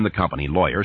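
ordinary delivery

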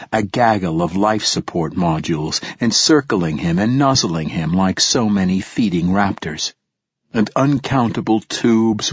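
ordinary delivery

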